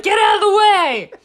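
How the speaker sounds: loud, high excited shout; playful, pushy yell of excitement. Retold or imitated. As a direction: shout it, bursting to get started. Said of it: "how excited I was to start this".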